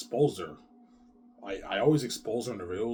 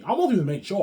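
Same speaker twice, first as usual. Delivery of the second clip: loud boast; 10 semitones higher, 12 dB louder than usual. Real, not acted. Boasting at wife.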